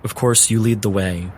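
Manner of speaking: usual speaking voice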